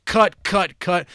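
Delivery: loud, high, sharp repeated cut; staccato cuts. Retold or imitated. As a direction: punch out each cut like a jarring edit. Imitates abrupt film editing.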